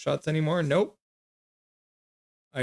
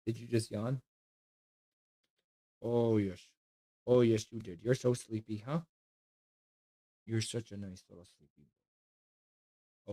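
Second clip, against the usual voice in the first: much softer, lower cooing to a sleepy puppy; 3 semitones lower, 8 dB quieter than usual. Real, not acted. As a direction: hush your voice and coo over a drowsy dog. Soothing her sleepy dog.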